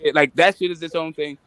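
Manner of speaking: ordinary delivery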